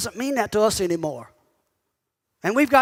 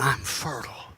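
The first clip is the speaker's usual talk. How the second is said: much lower and softer, a hushed come-hither "I'm fertile."; low sultry murmur; 11 semitones lower, 9 dB quieter than usual.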